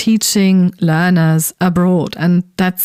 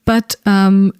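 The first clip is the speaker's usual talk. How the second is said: ordinary talk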